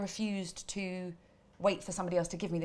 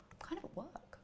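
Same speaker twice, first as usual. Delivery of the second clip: hushed, voiceless phone whisper; acted secretive whisper; furtive whisper; 11 semitones higher, 14 dB quieter than usual. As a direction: whisper it so colleagues won't hear. Furtive phone call at work.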